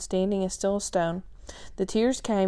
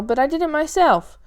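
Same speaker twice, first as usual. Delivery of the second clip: excited; 7 semitones higher, 6 dB louder than usual. Acted. Sal proud of her tattoos.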